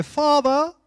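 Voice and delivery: put-on a child formally calling out father; mock-formal child's call to a parent; child saying father. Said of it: "did they turn around and go".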